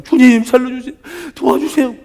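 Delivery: high, loud imitation of desperate pleading; tearful begging. Woman in tears begging the Lord, high desperate plea. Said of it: "여인은 막 눈물, 콧물 돼가지고".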